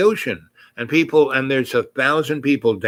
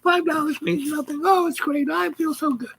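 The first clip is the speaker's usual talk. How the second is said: high falsetto gush of delight; very high-pitched, thrilled imitation; ecstatic gushing. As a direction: mimic an overjoyed woman, squeaky high. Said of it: "she is just ecstatic".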